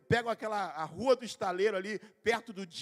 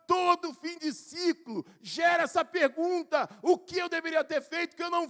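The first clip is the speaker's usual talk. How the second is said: loud, high, slow preacher's shout; preaching at full force; 8 semitones higher, 7 dB louder, slower than usual. Acted. Preacher shouting the question to the church. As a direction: drive the point home to the congregation.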